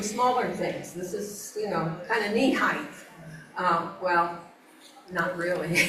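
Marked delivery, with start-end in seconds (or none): none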